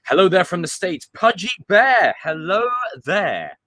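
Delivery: bright, loud, drawn-out hello; hammed-up hello there; excited greeting. Real, not acted. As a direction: call out a big welcome to a viewer. Greeting a fan in chat.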